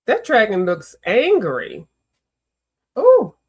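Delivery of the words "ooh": high loud startled ooh; sharp high ooh, louder; surprised ooh. Real, not acted. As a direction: jump at the fierce dragon. Reacting to angry dragon.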